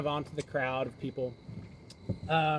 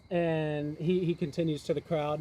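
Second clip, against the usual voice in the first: ordinary delivery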